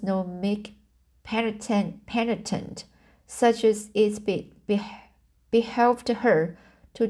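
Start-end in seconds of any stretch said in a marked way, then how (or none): none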